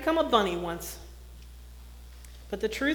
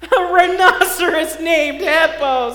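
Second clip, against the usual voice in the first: louder, higher, slow and laughing; laughing loudly; 8 semitones higher, 13 dB louder than usual. A narrator's reading. Fluffy teasing the rhinoceros about his name, loud laughing. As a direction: crow it through laughter. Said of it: "said Fluffy".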